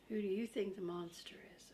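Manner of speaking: ordinary delivery, nothing marked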